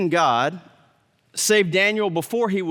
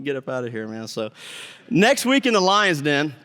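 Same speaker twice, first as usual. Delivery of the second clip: ordinary delivery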